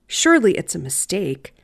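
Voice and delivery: ordinary delivery, nothing marked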